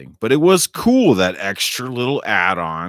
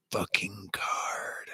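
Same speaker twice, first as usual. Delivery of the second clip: whispered curse; 9 dB quieter than usual. Real, not acted. Cursing the dreaded tarot card.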